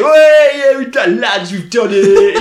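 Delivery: loud, high mock crowd cheer; hammed-up triumphant shouting. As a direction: cheer like soldiers celebrating a victory. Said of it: "all stand up and cheer".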